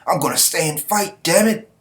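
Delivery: high, insistent protest; defiant boy. Acted. Boy defiantly refuses to run.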